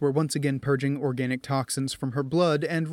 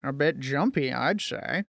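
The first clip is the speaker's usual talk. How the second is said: dry remark. A narrator's reading. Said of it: "Ben commented dryly".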